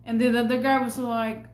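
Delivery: usual speaking voice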